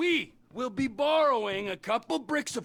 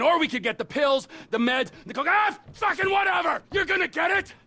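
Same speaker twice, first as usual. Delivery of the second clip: exasperated boss blowing up, louder and faster; fed-up shouting at the crew; frustrated yell; 5 semitones higher, 4 dB louder, faster than usual. Acted. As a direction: lose your patience and bark the orders. Frustrated boss loses patience, yelling.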